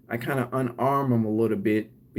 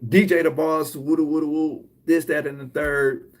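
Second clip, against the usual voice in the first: mock rant; 4 semitones higher, 3 dB louder than usual. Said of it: "if I'm like".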